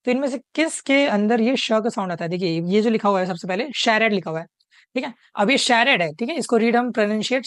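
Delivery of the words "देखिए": normal talking voice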